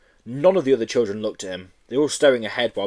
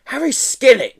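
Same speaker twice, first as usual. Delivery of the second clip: bawled name, high and loud; bawling; 7 dB louder than usual. Acted. Teacher calling the monitor out, bawling. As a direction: bawl the name across the class. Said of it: "she bawled".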